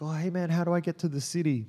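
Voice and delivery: mock call from a car; retold yell. Driver hailing her from his car, retold yell. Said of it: "yells out from his car".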